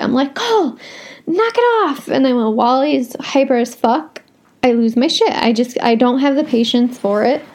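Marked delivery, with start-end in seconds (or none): 0.3-2.1 high, sharp scolding shout at the dog; yelling at the dog. Retold or imitated. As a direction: snap at the dog to stop, pitched way up. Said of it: "like, losing my shit".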